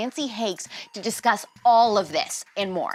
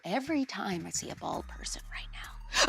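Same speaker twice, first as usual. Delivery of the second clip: mock wary whisper; 11 dB quieter than usual. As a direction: voice drops away into a whisper. Mock vigilance about bald men.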